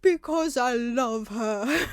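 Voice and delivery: a hammed-up lovesick plea; mild mock shriek. A narrator's reading. Draco defending his love, slow hammed plea. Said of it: "Draco shrieked".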